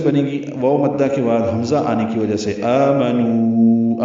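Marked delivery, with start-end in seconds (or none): 2.6-4.0 آمنوا with the vowels stretched out very slowly; long madd. Shown as a demonstration. Teacher showing students the four-count stretch, slow and drawn. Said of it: "چار حرکات لمبا کریں گے".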